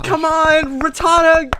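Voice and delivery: high, loud pleading call; mock urging. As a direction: cheer it on in jest.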